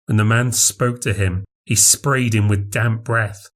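usual speaking voice

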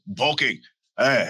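usual speaking voice

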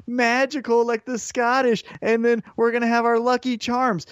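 high sing-song mockery; mocking high taunt. Mocking New Day taunt quoted.

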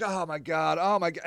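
soft, low aside; muttered dismay. Retold or imitated. Helper being threatened and hugged by a drunk, muttered dismay. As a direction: mutter it under your breath.

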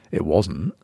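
ordinary delivery

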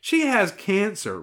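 put-on high, drawn-out girl voice; girl character's correction. A narrator's reading. Girl corrects the sympathetic wolf, high-voiced. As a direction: pitch it up and stretch it, as a girl.

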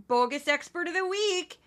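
high, loud hammed-up Midwestern announcer; a bouncy mock Midwestern game-show call; goofy Midwestern voice. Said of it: "I do a goofy vo-, goofy Midwestern voice".

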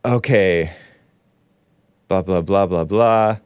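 ordinary delivery

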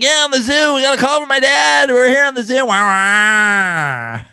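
high, loud hyped radio host voice; morning radio DJ. Retold or imitated. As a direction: pump it up like a morning DJ. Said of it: "Why do you sound like a morning radio D J?".

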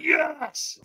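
high-pitched, fired-up yes; retold stronger yes. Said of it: "And I went".

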